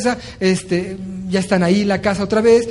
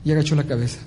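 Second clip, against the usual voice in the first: usual speaking voice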